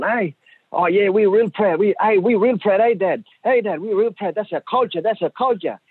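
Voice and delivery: piping up eagerly to get Dad to agree; excited proud sons. Retold or imitated. Sons boasting to Dad about their culture, excited and proud.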